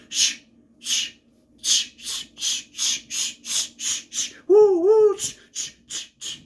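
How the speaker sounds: long run of "shh" with high loud woos; comic shushing. Retold or imitated. Larry's funny moment, endless mock shushing. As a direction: shushes breaking into high woos, then fading. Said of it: "Larry's just going".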